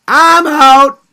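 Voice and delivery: loud, final I'm out; emphatic exit. Real, not acted. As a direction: slam the door on it. Quitting the whole topic, emphatically.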